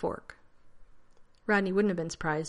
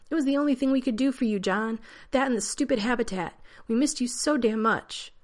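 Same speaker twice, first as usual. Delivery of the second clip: yelling; 6 semitones higher, 5 dB louder than usual. A narrator's reading. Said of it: "he shouldn't have been yelling".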